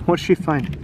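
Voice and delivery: plain reading voice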